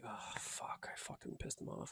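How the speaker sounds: whispered guilty aside to himself. Pretend. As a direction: whisper it as if caught out. Guilty aside after dismissing Clippy.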